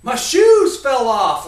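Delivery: loud, slow, plaintive wail; boy shouting his excuse to dad; shouted excuse. Retold or imitated. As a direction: drag it out loud like a stranded kid.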